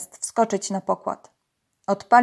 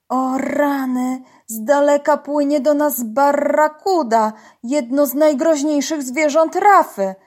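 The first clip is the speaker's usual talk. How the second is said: alarmed warning; 5 semitones higher, 5 dB louder than usual. A narrator's reading. Uncle warns divers of a barracuda, alarmed. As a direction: warn them of danger, a touch dramatic.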